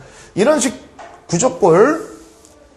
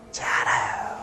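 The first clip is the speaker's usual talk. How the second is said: whisper; 9 dB quieter than usual. Real, not acted. Teacher's aside to class.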